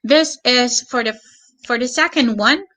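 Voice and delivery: normal talking voice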